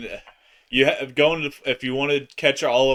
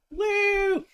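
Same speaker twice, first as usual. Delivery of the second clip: cheering. Real, not acted. Cheering a viewer.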